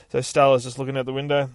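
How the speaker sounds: ordinary delivery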